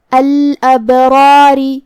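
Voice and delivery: الابراری with a thin, light final ri; slow recited الابراری, lighten the last ra. Shown as a demonstration. Teacher demonstrates الابراری with the last ra made light. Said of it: "ہمیں یہ والے را کو ہلکی آواز میں پڑھنا ہے".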